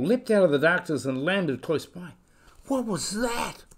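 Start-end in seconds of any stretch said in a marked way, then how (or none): 2.7-3.6 high, startled little voice; frightened cry. Acted. Kiwi chick startled by a frog, frightened cry. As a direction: blurt it out in a fright. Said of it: "little Kiwi cried".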